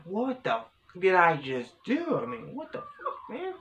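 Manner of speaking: high, strained cry of pain, half shouting; reliving the shock of sudden back pain; pained outburst. Retold or imitated. Retold waking in back pain.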